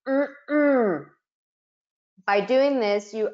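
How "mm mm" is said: giraffe hummed in two beats through shut lips, higher; a muffled two-beat hum for the syllables; hummed through closed lips. Shown as a demonstration. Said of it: "shout the word giraffe through closed lips".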